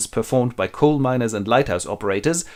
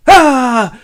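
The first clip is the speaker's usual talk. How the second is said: loud, high pouncing "ah"; sudden yell; 12 semitones higher, 12 dB louder than usual. Acted. Scrooge pounces on late Cratchit, yelling. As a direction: burst out with a startling yell.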